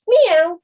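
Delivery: sudden high, loud meow; a loud cat meow out of nowhere; mock meow. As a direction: meow right in someone's face. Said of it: "right in his face went".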